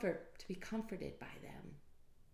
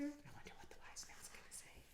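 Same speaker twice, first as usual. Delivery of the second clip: fast curious whisper; 10 semitones higher, 12 dB quieter, faster than usual. Acted. Storyteller wondering to the children, whispered. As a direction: lean in and whisper. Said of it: "aside to children".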